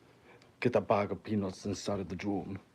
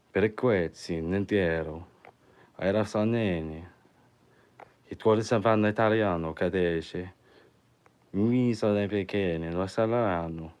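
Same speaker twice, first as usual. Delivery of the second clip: mock Italian; 5 dB louder than usual. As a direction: fake Italian gibberish, louder. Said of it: "Are you a, like, a New York Italian?".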